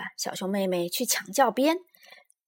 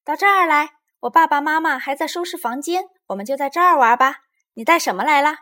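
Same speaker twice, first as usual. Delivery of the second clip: put-on loud, higher-pitched child's call; 7 semitones higher, 10 dB louder than usual. A narrator's reading. Lizi calls her friend over to the garage, calling out. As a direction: shout it across to a friend. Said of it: "丽兹在车库喊".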